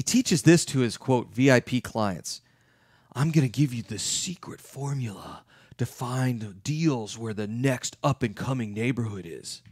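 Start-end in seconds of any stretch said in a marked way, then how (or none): 3.1-9.6 hushed, secretive sales-pitch voice; softer, lure them in with a secret; mock guru pitch. Real estate guru teasing his VIP clients, hushed and secretive. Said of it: "There's a real estate guru in town that teaches".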